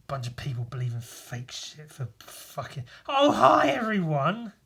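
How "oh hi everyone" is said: high, loud hello to everyone; excited greeting. Real, not acted. Streamer suddenly greeting arriving viewers, excited. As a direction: burst out with a high, drawn greeting.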